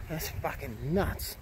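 slow, quiet disbelief; awed mutter. Real, not acted. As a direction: breathe it out in awe. Awed by flooded car park.

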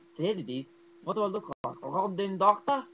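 loud, high, indignant doctor voice; hammed-up irritated character questions; bad Nazi doctor impression. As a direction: fire back as an offended movie doctor. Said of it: "That's probably a terrible impression".

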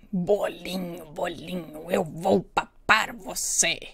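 low menacing whisper; wolf voice. Acted. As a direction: hiss it like a hungry wolf. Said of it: "disse o lobo". (sussurro ameaçador de lobo)

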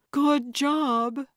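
high, loud call across the water; calling from shore. A narrator's reading. Carlotta cheers on the paddlers from shore, calling out high and loud. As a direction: project cheer to people out on a boat. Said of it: "Carlotta called from shore".

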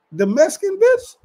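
high, loud disbelieving "The Mexican bitch?"; sudden jump up in pitch, incredulous; shocked question. Retold or imitated. Retelling the call, shocked to hear which girl left.

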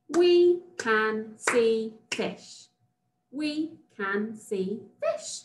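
"We can see fish" very slow, a gap between words; slow, separated teacher's dictation of "We can see fish"; word-by-word modelling. Shown as a demonstration.

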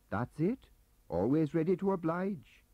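chatty, eager Welsh clerk. Acted. Gaunt agreeing.

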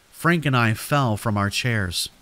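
ordinary delivery, nothing marked

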